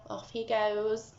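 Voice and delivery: plain reading voice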